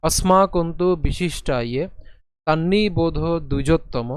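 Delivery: slow, careful recitation of the line; slow model recitation. Shown as a demonstration. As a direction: recite the line slowly for learners. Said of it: "আরেকবার প্রথম লাইনটি যদি আমরা উচ্চারণ করি".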